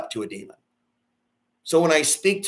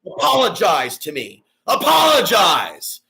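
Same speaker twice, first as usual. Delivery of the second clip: demanding shout; 3 semitones higher, 5 dB louder, slower than usual. Real, not acted. Demanding critics apologize, slow shout. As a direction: draw out the word as an order.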